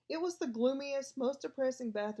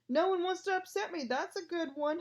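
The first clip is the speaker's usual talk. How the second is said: howling; 7 semitones higher than usual. A narrator's reading. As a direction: wail it out, wounded and scornful. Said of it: "howled Myrtle".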